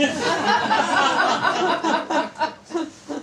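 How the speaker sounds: bursting into real laughter; a helpless loud laugh at the text. Real, not acted. Reader breaks into laughter mid-sentence.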